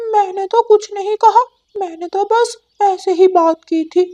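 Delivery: high, bright cartoon parrot voice; talking parrot; chatty parrot. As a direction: squawk it out like a cheeky parrot bird. Parrot answers Gauri.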